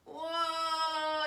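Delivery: big playful whoa, loud and high; excited whoa. Pretend. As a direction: throw out a loud delighted whoa as the bus climbs. Yoga bus drives up hill.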